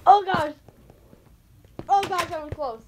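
high, loud startled cry; alarmed. Real, not acted. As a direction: cry out after a near miss. Shaken by near miss.

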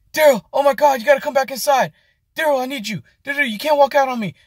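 high, frantic, pleading manager; panicked manager pleading. Retold or imitated. Manager begs cook not to leave, high frantic plea. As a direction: squeal it like a boss losing it. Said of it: "Daryl was looking at him".

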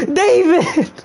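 high-pitched mock-anguished call; melodramatic cry. Player calling to David, melodramatic cry. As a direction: cry the name out in horror. Crying out to victim in game.

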